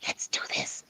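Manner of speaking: unvoiced soft whisper of the same words. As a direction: drop to a breath. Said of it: "you just whispered it".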